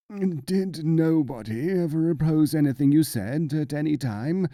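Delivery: put-on peevish, complaining question from an old monk; querulous. A narrator's reading. Old monk cuts in on the boastful mullah, querulous. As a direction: whine it out, irritated by the boasting. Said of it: "he asked querulously".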